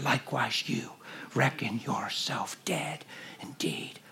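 slow, breathy whisper of the verse; hushed whisper. Acted. Preacher whispering scripture to the congregation. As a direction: whisper the scripture slowly, as a secret.

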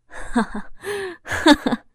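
sneering bitter ha-ha; cold laugh. Acted. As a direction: laugh coldly at himself. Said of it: "老公冷笑着". (冷笑着哈哈两声)

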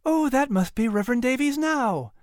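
high, lilting woman's voice; bright guess at arrival. A narrator's reading. Sarah guesses the reverend has arrived, pleasantly excited. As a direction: call it out high in happy anticipation.

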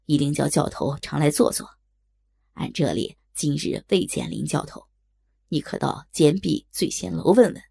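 low, affable shopboy voice; kind waiter. A narrator's reading. Waiter recognising the instructor's name and directing the maid, helpful. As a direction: play the helpful waiter, pitched well down. Waiter figure in narrated story.

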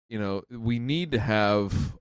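ordinary talk

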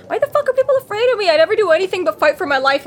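high-pitched mock exasperation; indignant disbelief. Fan voicing Harry baffled that people fear him, indignant. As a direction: protest in a hammed-up high voice.

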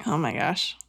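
soft, choked up with real feeling; moved near to tears, quietly; hushed, tearful reaction. Real, not acted. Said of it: "You're gonna make me cry".

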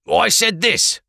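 high, thin voice of a frightened prisoner; pleading prisoner voice. Acted. Prisoner pleading with the Blackcoats, high and anxious.

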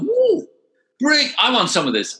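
high, thrilled whoop then eager words; excited thought. Retold or imitated. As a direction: caught up in the race-day buzz. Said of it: "And I thought".

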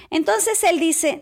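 normal talking voice

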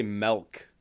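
MILK said as "melk"; mispronounced MILK. Retold or imitated. Mocking how some people say it, MILK as "melk". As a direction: imitate people who turn MILK into "melk". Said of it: "That's like people that say milk".

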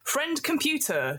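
lady in red armor. Acted. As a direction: address the computer with reverence. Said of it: "sort of goes".

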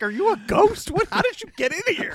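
high, startled mock voice for Arthur. Podcast host voicing Arthur seeing Morgana glide in, high and startled. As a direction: squeak it out like a spooked prince. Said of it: "He's like".

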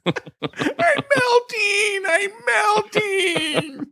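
very high, drawn-out mock wail; hammed-up dying witch cry; melting witch wail. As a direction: stretch it out in a high, crying screech.

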